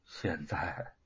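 soft, hollow voice of ruin; a touch of numb despair; anguished, bitter. A narrator's reading. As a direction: say it quietly, as if everything is lost. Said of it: "他的声音凄苦而痛楚".

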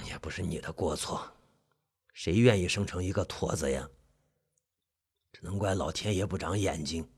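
very low, hushed husky voice; hoarse, low uncle. Acted. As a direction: grumble softly, deep and hoarse. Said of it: "伯父的声音沙哑的有几分低沉".

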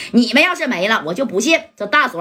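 ordinary delivery, nothing marked

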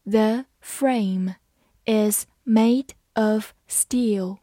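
slow, careful model reading; slow demo for learners. Shown as a demonstration. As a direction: read each word slowly. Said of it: "好，慢慢来读。".

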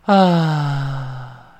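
long loose sigh out; relaxed sigh. Shown as a demonstration. Teacher demonstrating a sigh for relaxed mouth. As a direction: let a tired breath go. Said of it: "就叹一声气".

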